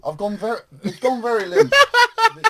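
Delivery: high giggling through words; laughing. Real, not acted. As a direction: laugh while talking. Laughing at broken object.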